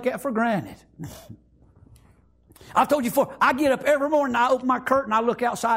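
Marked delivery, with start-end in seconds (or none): none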